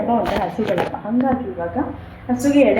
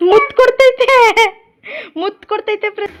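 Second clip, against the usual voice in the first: delighted squeal; 11 semitones higher, 5 dB louder than usual. Real, not acted. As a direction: squeal with delight over a little kiss. Delighted squeal over a kiss given. (ಖುಷಿಯಿಂದ ಕಿರುಚುವ ಮುದ್ದು ದನಿ)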